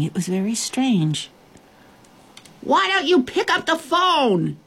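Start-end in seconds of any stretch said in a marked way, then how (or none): 2.6-4.6 put-on high-pitched girl's distant shout. A narrator's reading. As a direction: call it across a long distance. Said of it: "the little girl shouted even louder".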